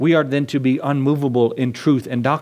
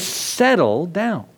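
slow calming; much slower than usual. Retold or imitated. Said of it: "He says".

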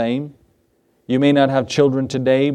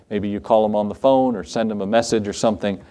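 usual speaking voice

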